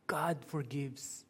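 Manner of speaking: soft, slow statement; hushed, unhurried reassurance; quiet assurance. Real, not acted. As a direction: let it land gently and slowly. Same words, said softly after lament.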